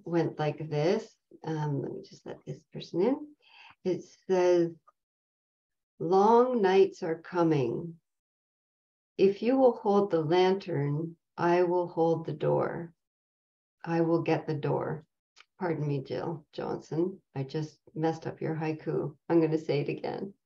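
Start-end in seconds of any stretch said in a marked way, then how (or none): 6.0-15.1 poem recitation. Acted. Reads a haiku aloud.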